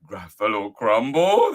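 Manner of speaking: loud, rising, alarmed "Gruffalo Crumble"; startled Gruffalo. Acted. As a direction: echo "Gruffalo Crumble" in disbelief, rising at the end. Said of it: "the Gruffalo said".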